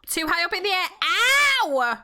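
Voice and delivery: high alarm into a yelped ow; pained yelp. Real, not acted. Player falling from too high, high yelp. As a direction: cry out as you come down hard. Mishap flying in the game.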